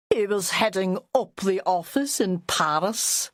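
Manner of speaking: mocking plummy toff voice; music hall posh. Jack mocking Joanna's rich husband, music hall posh. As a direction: lampoon a rich snob. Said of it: "made it music hall posh".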